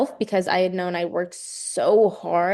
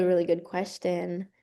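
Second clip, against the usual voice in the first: usual speaking voice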